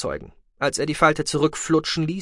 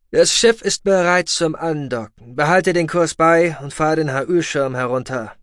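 raised, louder official announcement; commanding officer; 4 semitones higher, 5 dB louder than usual. A narrator's reading. Officer ordering Goloshir to prepare for docking, commanding. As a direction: give orders as a radio officer.

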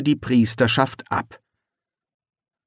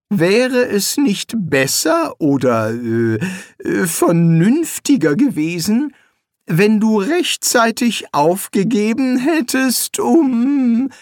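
much higher, patient talking-down; as to a slow child; 9 semitones higher, 3 dB louder, slower than usual. A narrator's reading. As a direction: explain it to a dim child. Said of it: "in dem Ton, den man begriffsstutzigen Kindern gegenüber benutzt". (herablassend wie zu einem begriffsstutzigen Kind)

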